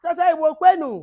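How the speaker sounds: high, loud crowd call; call-and-response shout; rallying call. Real, not acted. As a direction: chant it out to rouse the group. Rallying call, audience answers Yeah.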